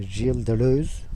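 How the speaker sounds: ordinary talk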